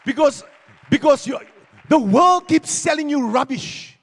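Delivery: loud, slow preaching shout; preaching at full force. Real, not acted. Pastor calling the church for a clap, shouting. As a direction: drive it home to the congregation.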